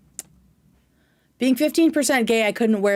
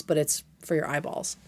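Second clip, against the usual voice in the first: ordinary talk, nothing marked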